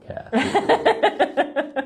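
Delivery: high, slow laughing host voice; talk show host. Pretend. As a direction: ham up the cheery TV host. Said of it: "we are Regis and Kathy Lee".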